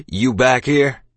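loud accusing question; hostile challenge. A narrator's reading. Captain finding the boy back, hostile.

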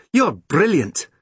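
put-on high, thrilled child's voice; delighted praise. A narrator's reading. Sister hugging her clever brother, delighted.

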